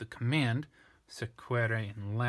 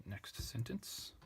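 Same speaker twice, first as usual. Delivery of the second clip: whispered aside; 9 dB quieter, much slower than usual. Real, not acted. Whispered aside while teaching.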